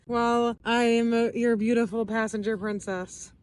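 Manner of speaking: usual speaking voice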